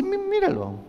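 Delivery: very high urging voice; pleading mother. Retold or imitated. Mother urging the pastor to look, very high.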